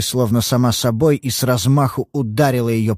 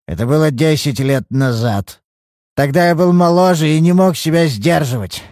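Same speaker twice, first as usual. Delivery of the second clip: higher, louder self-pitying excuse; a touch of whining self-pity; 5 semitones higher, 4 dB louder than usual. Acted. Old father excusing himself to the detective, self-pitying. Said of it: "полон жалости к самому себе".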